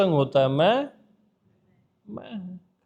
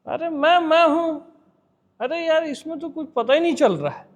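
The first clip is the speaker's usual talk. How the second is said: higher, mock grumbling seeker; imitated listener's complaint; 6 semitones higher than usual. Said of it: "ऐसा बोलते हो आप".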